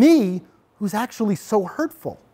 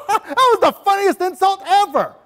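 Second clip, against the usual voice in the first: laughing high as a sitcom audience; mock a sitcom crowd cracking up; hammed-up laughter; 12 semitones higher than usual. Teacher mocking a TV studio audience loving an insult, laughing.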